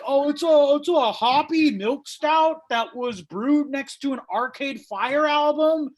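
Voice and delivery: high, loud craft-beer snob voice; snobby bartender. Retold or imitated. Comic mocks a hipster bartender's pitch, snooty high voice. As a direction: oversell it like a pompous brewer. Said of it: "selling me on this, like".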